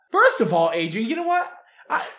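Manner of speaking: loud, high-pitched indignant outburst; indignant confrontation. Acted. Player character confronts Adrian angrily.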